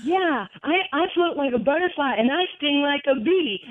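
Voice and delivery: excited boast. Retold or imitated. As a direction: toss back his own line with glee. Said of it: "I said".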